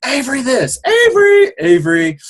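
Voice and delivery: high, loud mimicked name-calling; mock calling out. Father mimicking name heard everywhere at grocery store, mock calling out. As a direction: imitate shoppers calling a name all over.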